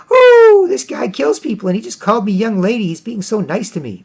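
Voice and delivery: hoher, quietschender Schwärm-Ausruf; squealing gush. Retold or imitated. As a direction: als verliebte Frau kreischen. Woman gushing, squealing.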